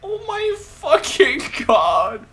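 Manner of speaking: high, loud, slow sob-shout; crying shout. Real, not acted. Streamer mourning Gundam on screen, shouting through tears. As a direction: let the cry break through the words. Reacting to a character's death.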